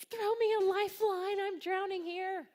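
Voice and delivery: high, sustained mock cry for help; hammed-up drowning wail; imitated desperate plea. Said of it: "when she was like".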